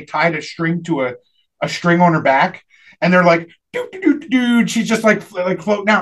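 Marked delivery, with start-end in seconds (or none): 3.7-4.7 high mocking doo doo hum; mock spooky hum. Mocking a cheap movie effect with a high spooky hum. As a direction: hum a cheesy floating-ghost tune.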